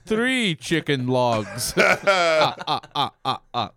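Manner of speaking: put-on counting with a light vampire accent; Count from Sesame Street. Counting chicken logs like the Sesame Street Count, hammed-up vampire accent. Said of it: "count from Sesame Street".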